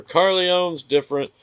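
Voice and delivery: hiccups. Real, not acted. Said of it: "I got the hiccups so bad".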